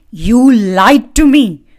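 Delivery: loud, slow, high yell; screamed accusation. Acted. Hunter to the bird who tricked him, loud slow scream. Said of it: "screamed the hunter".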